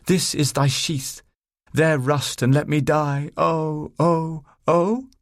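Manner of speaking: high, slow tragic declaiming; hammed-up stage death, rising into drawn-out cries; reciting Shakespeare. A narrator's reading. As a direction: declaim like an amateur tragic heroine. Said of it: "She was reciting Shakespeare".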